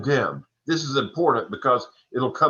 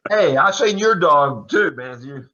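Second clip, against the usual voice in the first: louder, higher teasing call; playful call-out; 3 semitones higher, 6 dB louder than usual. Real, not acted. The speaker jokingly calls out to Matthew about his dog, loud and playful.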